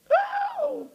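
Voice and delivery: a sudden joyful high whoop. Real, not acted. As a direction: let out a high praise whoop.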